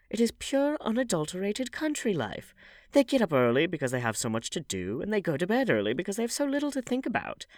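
put-on high, lively voice of a gossiping old lady; chatty old hostess. A narrator's reading. Old hostess teasing Dorian at her dinner party, high and chatty. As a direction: prattle on like a bossy society dowager.

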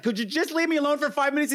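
high exasperated whine; high mock exasperation. Podcaster imitating annoyed Helen, high whine. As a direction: beg for peace in a high whiny voice. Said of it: "she's just like".

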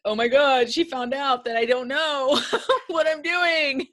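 half-laughed mock horror; laughing mock panic. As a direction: play caught out, laughing it off. Imitated panicked prospect.

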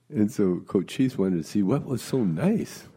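usual speaking voice

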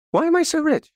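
Why am I so rich? pitched high and eager; excited question. Shown as a demonstration. Coach testing his new question, high and eager. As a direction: lift the question up with wonder. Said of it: "And then I said".

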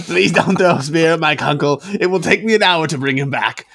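loud, high mock plea; gnome pleading. Gnome begs players to spare his bat, high loud pleading.

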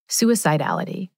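normal talking voice

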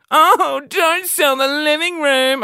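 hammed-up wail, high and loud, slow; fake crying [name]. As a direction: wail like a mock tearful seller. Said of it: "and stand there and cry".

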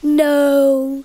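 loud put-on silly monster voice; grumpy guitar voice. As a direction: bark it out as a cranky guitar. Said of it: "Who talks like that?".

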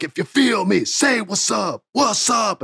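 high, stretched-out rap chant; R-Truth. Retold or imitated. Wrestler doing his rap to a wild crowd, high drawn-out hype. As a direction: get the crowd to call back, drawing it out. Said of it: "he's doing his rap".

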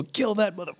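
high-pitched hyped cry, not truly shouted; imitated hype. Imitating teammates getting hyped for a kill, mock hype. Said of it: "just getting hype".

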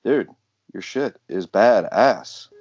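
ordinary delivery

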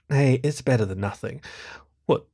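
usual speaking voice

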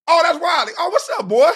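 high-pitched imitated fan hello; mock excited greeting. Strangers recognizing a comedian, imitated excited greeting.

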